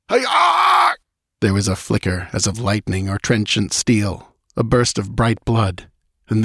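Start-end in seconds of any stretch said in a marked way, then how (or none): 0.1-0.9 very high scream cut short; death scream. Acted. Priest struck down mid-report, death scream. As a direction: scream out as the blow lands.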